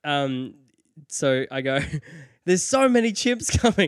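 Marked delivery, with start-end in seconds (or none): 2.4-3.9 high, louder cry breaking into laughter; mock-panicked warning. He warns the crowd about the chimps, hammed-up high panic. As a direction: play the alarm through giggles.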